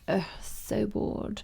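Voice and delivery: slow, soft, drawn-out mock boredom; hammed-up languid teenage disdain; bored, snooty. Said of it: "just too cool for school and really looks down her nose at everybody".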